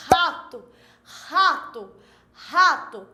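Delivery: rato said three times, the initial R a strong guttural h; teacher repeats rato, leaning on the throaty R; R sound demo. Shown as a demonstration. Teacher showing pupils the initial R, repeated demo. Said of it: "vai dar aquele sonzinho assim".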